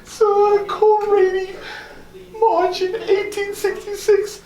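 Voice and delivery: high, slow, tearful wail; sobbing. Acted. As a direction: break down crying over the loss. Grieving loss of wife, child.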